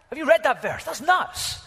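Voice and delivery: high, loud burst of disbelief; incredulous amazement. Acted. Preacher repeats question about startling verse, loudly incredulous. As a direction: shoot the pitch right up, astounded. Second take, astonished at miracle.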